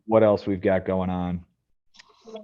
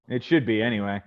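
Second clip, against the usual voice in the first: ordinary talk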